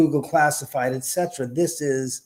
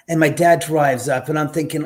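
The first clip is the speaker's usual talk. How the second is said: ordinary talk, nothing marked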